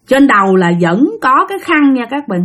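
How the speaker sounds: plain reading voice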